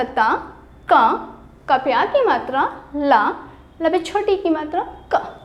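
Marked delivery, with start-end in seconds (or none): none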